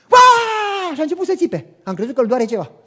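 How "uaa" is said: put-on a big excited kid's whoop, much louder and higher; child's joyful scream. Little son whooping over his new bike, joyful scream. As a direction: whoop like a thrilled little boy.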